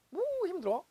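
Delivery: mocking high scoff. Wife scoffing at hardship on the phone, high mocking tone. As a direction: scoff as if it's nothing at all. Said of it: "하나도 안 힘들어요".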